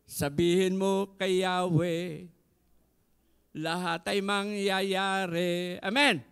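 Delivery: slow, loud declaration; solemn preaching. Acted. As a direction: declare it slowly.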